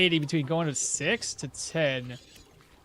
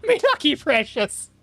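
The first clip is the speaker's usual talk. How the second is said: high, laughing creature voice with Irish lilt; mock Irish Gollum; 10 semitones higher, 4 dB louder than usual. Streamer answers chat's Irish Gollum request, giggling through it. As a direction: cackle it out, greedy and lilting. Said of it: "That was Irish Golem?".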